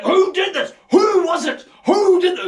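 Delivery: loud, high outraged yells; a mob demanding to know who did it; angry villagers shouting. Retold or imitated. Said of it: "they're like".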